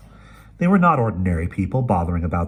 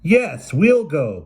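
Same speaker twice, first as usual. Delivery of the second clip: loud, pitched-up declaration; booming; 10 semitones higher, 6 dB louder, much slower than usual. Acted. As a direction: boom it from the doorway. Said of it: "boomed Mattie".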